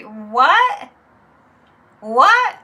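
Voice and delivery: incredulous cry; outraged disbelief. Real, not acted. Reacting to the January sixth claim, outraged disbelief.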